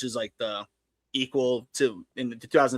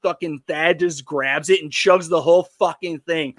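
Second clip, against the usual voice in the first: usual speaking voice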